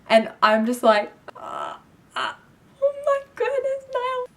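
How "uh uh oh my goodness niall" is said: starstruck. Retold or imitated. Starstruck fan seeing idol.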